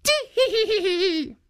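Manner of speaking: very high tittering giggle; high old woman's tee-hee. A narrator's reading. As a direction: giggle it out high like a tickled granny. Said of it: "could not help laughing".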